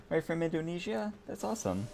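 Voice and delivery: sudden squeaky high pitch, dropping to a soft hush; eccentric high voice. Real, not acted. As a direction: pop way up high and go quiet, oddly. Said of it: "I don't know why I said it like that".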